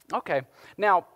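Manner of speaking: ordinary delivery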